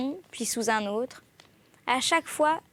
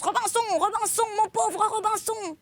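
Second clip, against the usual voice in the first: very high, strident parrot voice; crying out desperately; 9 semitones higher than usual. A narrator's reading. Abandoned parrot calling its master from afar, high screeching lament. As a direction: wail piteously at the top of the range. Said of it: "en criant de toutes ses forces".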